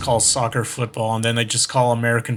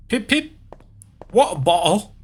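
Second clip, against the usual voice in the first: high, silly mock British; hammed-up British caricature; bad British accent; 6 semitones higher than usual. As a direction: toss it out in a fake British voice. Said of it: "I'm doing a shitty British accent".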